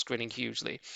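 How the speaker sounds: ordinary delivery, nothing marked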